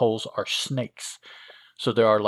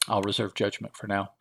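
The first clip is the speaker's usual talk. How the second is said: usual speaking voice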